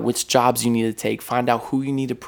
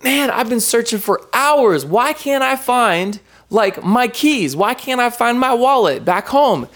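frustrated searching; 11 semitones higher than usual. Retold or imitated. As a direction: whine about the lost keys. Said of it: "I was like".

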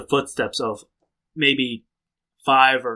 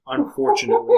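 mock regret. Mock-sorry DM line.

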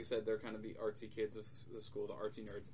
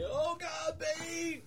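high-pitched pretend shout; hammed-up panic; mock terrified cry; 3 dB louder, much slower than usual. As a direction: cry out as if the villain appeared. Kid sees monster as worst fear.

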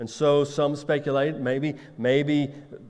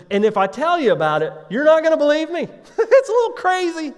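high, loud voice climbing into a laugh; acted amazement, pitch shooting up at the end; incredulous excitement; 14 semitones higher, 7 dB louder, faster than usual. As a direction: build to a giddy, squeaky exclamation. God announces something unbelievable, laughing.

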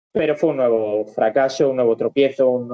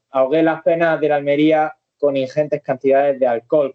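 usual speaking voice

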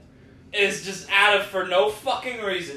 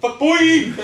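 higher, louder mocking outburst; duck-faced principal imitation; 8 semitones higher, 5 dB louder than usual. Friend mimics the principal's duck face, mocking and high. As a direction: pout it out in caricature. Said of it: "the dumbass duck face he makes".

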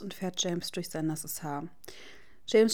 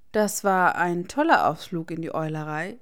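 cold; 7 dB louder than usual. A narrator's reading. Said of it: "sagt sein Freund kalt".

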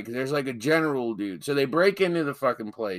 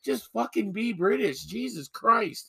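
exasperated; 7 semitones higher than usual. Real, not acted. Co-host begging the actors to be British, comically exasperated.

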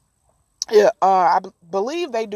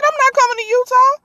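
indignant; 12 semitones higher, 5 dB louder, faster than usual. Retold or imitated. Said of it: "He said".

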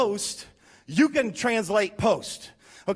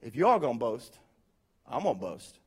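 calm comeback; 6 semitones lower, 4 dB quieter than usual. Retold or imitated. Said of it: "Paul's like".

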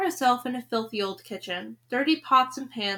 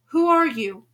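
raised, sharp hissed question; louder, higher challenge to an intruder; 5 semitones higher, 8 dB louder than usual. A narrator's reading. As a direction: demand to know who's there. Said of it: "the old woman hissed".

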